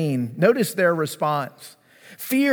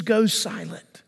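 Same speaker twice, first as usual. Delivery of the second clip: whisper; 2 semitones higher, slower than usual. Acted. Winds calmed at his word.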